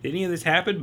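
high, incredulous what-the-hell; baffled outrage. Retold or imitated. First watching the movie, baffled high disbelief. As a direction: squeal it out in disbelief. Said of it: "I was like".

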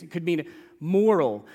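ordinary talk, nothing marked

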